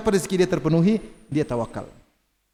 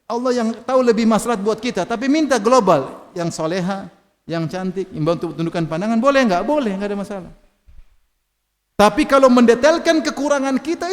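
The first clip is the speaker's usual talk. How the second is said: ordinary talk, nothing marked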